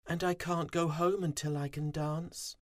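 stuck far from the hive; little bee despair; Fuzzy pleading. A narrator's reading. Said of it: "They shook their heads".

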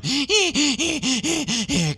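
high witchy cackle; evil cackle. A narrator's reading. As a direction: cackle wickedly. Witch arrives in story.

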